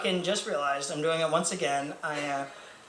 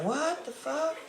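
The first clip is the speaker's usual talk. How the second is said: shocked reaction; 10 semitones higher, 5 dB quieter, slower than usual. Retold or imitated. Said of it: "I was just like".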